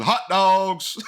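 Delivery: loud, high ballpark vendor yell; stadium vendor hawking. Shown as a demonstration. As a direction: bellow it out to the whole stadium. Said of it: "I guess I'd just go around yelling".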